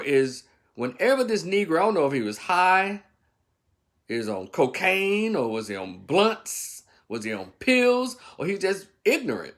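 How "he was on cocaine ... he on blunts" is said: normal talking voice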